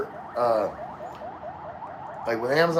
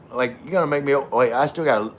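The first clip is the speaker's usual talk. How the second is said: ordinary delivery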